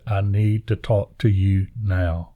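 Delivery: retold slowly, every word of "I need to talk to you now" spaced out; measured, deliberate demand with weight on "now"; slow insistence. Wife insists on third tap, retold.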